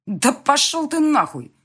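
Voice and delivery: loud, high, drawn-out furious curse; spat out angrily. A narrator's reading. Angry rival curses the father to his face, furious. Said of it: "выплюнул мне в лицо злой Марк".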